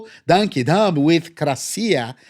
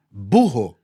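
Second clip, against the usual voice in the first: usual speaking voice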